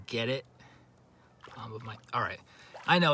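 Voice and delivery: ordinary talk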